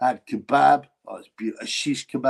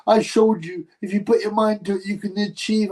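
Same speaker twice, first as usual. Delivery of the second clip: imitating a weepy victory speech, higher; whiny, higher mock sob-story voice; mock crying boxer; 7 semitones higher than usual. Mocking the crying fighter in his post-fight speech, higher and whiny. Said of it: "when he started crying, he going".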